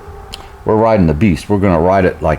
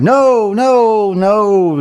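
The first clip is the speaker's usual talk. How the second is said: high, drawn-out imitation wail; hammed-up tearful protest, pitched high; mock crying refusal; much slower than usual. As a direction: wail it like a bawling child. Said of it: "she's crying all the way".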